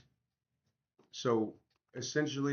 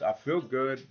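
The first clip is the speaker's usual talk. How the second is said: usual speaking voice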